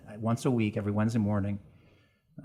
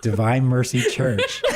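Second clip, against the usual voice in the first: laughing; 3 semitones higher, 10 dB louder, slower than usual. Real, not acted. Laughing while answering.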